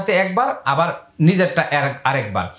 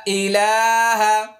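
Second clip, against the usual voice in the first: slow recitation; 2 semitones higher, slower than usual. Shown as a demonstration. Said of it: "আবার আয়না ধরুন".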